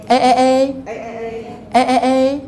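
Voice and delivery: A said with the lips spread like a smiling Buddha; wide smile E. Shown as a demonstration. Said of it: "我们叫弥勒佛". (像弥勒佛一样嘴巴前后开念A)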